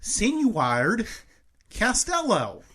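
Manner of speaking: high, drawn-out Sinuard Castello; mock fancy pronunciation. Shown as a demonstration. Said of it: "You gotta pinch your nose when you talk like that".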